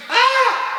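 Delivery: high, loud woman's scream; hammed-up piercing shriek; mimicked scream. Husband imitating wife's scream at an intruder. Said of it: "Myra screams".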